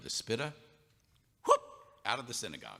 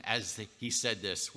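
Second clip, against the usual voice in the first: normal talking voice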